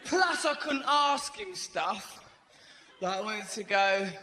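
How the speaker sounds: ordinary talk, nothing marked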